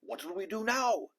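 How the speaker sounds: high, panicked cry; a frightened pirate calling out; panicked yell. A narrator's reading. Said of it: "yelled one of the pirates".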